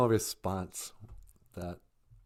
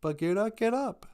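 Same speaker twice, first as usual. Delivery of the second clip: put-on high child's plea, climbing higher; small boy crying out, much higher; pleading little kid; 12 semitones higher than usual. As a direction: beg your fallen friend to wake up. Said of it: "he's like".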